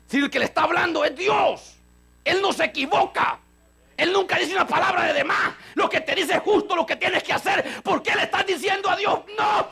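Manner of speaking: mucho más agudo y a gritos, predicando con fuerza; predicación encendida. Acted. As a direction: reprende a la congregación a todo pulmón. Preacher rises to full force. (predicador que clama con fuerza a su iglesia)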